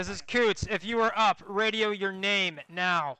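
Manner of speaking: loud, slow shouted order; shouting. Real, not acted. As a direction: bellow the roll call slowly. Roll call after attack.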